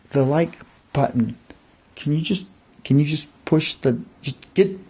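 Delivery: plain reading voice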